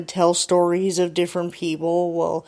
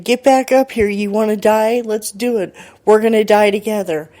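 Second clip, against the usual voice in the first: loud, higher-pitched mock shouting; raised-voice menacing demands, acted out; retold threatening yell; 4 semitones higher, 6 dB louder than usual. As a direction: imitate an attacker yelling down at his victim. Retold attacker yelling threats.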